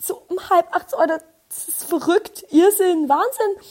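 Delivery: mock despair at half past eight; mock wailing. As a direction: wail about the early hour, half crying. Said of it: "Und ich so".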